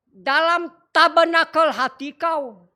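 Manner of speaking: ordinary talk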